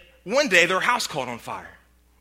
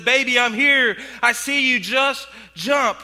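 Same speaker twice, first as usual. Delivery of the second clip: louder, higher reassuring call; 4 semitones higher, 4 dB louder than usual. Retold or imitated. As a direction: call up to her over the fire. Father calling up to trapped daughter.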